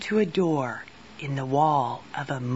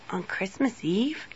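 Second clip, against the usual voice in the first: soft, questioning little grumble; mildly grumpy; 3 semitones higher, 7 dB quieter than usual. A narrator's reading. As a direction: grumble it quietly as a rising question. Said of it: "grumped the little sparrow".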